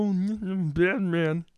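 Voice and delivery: low, slow, quiet Batman voice; Kermit the Frog voice. Acted. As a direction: growl it low and slow as a hero. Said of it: "I'm the same as Kermit the Frog voice".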